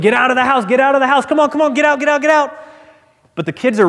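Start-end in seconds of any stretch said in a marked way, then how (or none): 0.0-2.5 frantic yelling. Retold or imitated. Father yelling at his children to flee the fire. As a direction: shout to the kids to get out of the burning house. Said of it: "the rich man begins yelling to his children".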